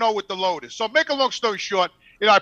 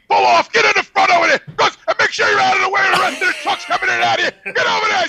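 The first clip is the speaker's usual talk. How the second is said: higher, louder barked orders, half laughing; mock irate shouting; 9 semitones higher, 4 dB louder than usual. Irate scale guy ordering the driver off, shouting. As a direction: order him off the scale like a furious clerk. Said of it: "the guy's really irate with me".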